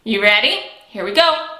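ordinary talk

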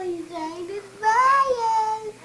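slow, loud excited announcement. Real, not acted. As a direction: call it out to mom happily.

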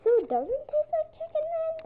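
high, loud little duckling voice; high, loud and persistent; nagging duckling. Acted. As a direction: keep pestering innocently.

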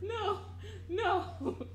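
giggling through the words; can't stop laughing. Real, not acted. Laughing at boyfriend's girl voice.

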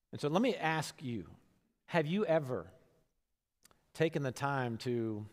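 ordinary talk

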